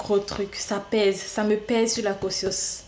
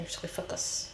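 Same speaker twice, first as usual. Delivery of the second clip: hushed self-pep whisper; breathy whispered resolve to concentrate; whispered focus; 11 dB quieter than usual. Real, not acted. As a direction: whisper it to yourself, steadying. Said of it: "Mais là, je vais prendre sur moi".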